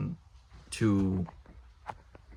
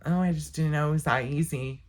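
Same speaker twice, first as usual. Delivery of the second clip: mocking quote; 6 semitones higher than usual. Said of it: "people will be like".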